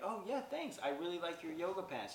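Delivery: high-pitched acted compliment; chirpy thanks. Shopper returning a compliment on pants, chirpy. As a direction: lift the voice high for polite thanks.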